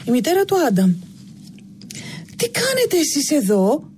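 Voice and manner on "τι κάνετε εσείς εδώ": higher, a mother bursting in to scold; furious mother's shout. A narrator's reading. Mother finds the children in the forbidden attic, angry shout. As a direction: snap at the children caught in the attic. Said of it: "φώναξε".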